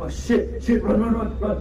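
high, loud panicked yelling; being chased. Real, not acted. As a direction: shout run while fleeing. Fleeing monster, yelling run.

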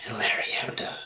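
whispered in a breathy voice. Pretend. Attempt at sexy sign-off.

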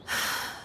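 soft, breath-only sigh; weary sigh. Acted. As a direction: let out a short, quiet sigh. Weary visitor dreads being here.

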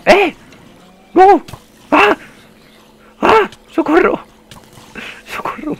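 very high, loud squealing panic; panicked shrieking. Real, not acted. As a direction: shriek for help. Panics, calls for help in game.

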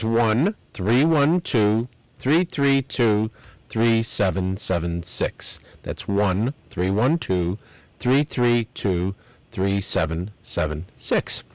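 slow, deliberate phone number, digit by digit; slowly. Shown as a demonstration. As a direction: spell out each digit so listeners can write it down. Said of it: "I'll say it slowly".

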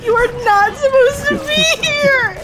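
high, teary half-laugh protest; choked up and laughing at once; laugh-crying. Real, not acted. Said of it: "trying not to cry from laughter and from emotion".

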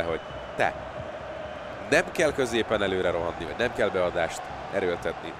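ordinary talk, nothing marked

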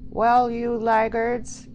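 loud, high, drawn-out shout; bawling. A narrator's reading. Joe greeting the late couple, bawling. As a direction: bawl it slowly at stragglers. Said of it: "he bawled as they came up".

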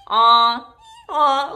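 loud ee-aw bray; donkey bray. Retold or imitated. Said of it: "Donkey says".

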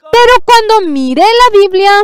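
high, loud preaching exclamation; a preacher telling the pastors what he found, in a high loud cry. Acted. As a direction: lift the line like a revelation. Preaching climax.

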